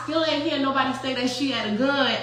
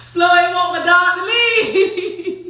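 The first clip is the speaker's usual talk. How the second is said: high, words breaking into laughter; laughing; 5 semitones higher, 4 dB louder than usual. Real, not acted. Cracking up while telling about Flo, laughing. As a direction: barely get it out through real laughter.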